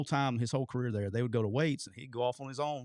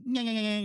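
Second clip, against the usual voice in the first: imitated wobbling kick noise; 10 semitones higher, 3 dB louder than usual. Punter's bad kick retold to the host, imitated high noise. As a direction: mimic a ball veering off in a high whine. Imitating shanked ball flight.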